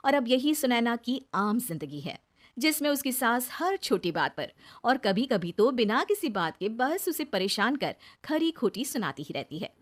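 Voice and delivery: plain reading voice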